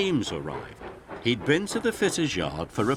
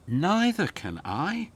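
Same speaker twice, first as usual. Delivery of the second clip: lightly wheezing Emily; 9 semitones higher than usual. A narrator's reading. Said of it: "wheezed Emily".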